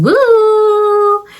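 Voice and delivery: high, loud whoop of excitement; excited whoop. Retold or imitated. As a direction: let out a giddy, high-pitched cheer. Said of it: "his mind is just going".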